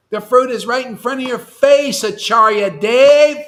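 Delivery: loud, high yell building on the name. Real, not acted. Teacher yelling at a disciple with wax in his ears, yelling. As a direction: holler it at someone hard of hearing. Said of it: "I have to yell".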